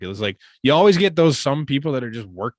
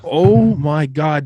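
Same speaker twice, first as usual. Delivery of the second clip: excited; 3 semitones higher, 9 dB louder, much slower than usual. Real, not acted. Excited exclamation about Canada.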